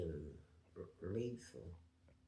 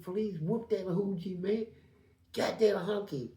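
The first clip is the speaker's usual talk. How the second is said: retold cheering; 8 semitones higher, 5 dB louder than usual.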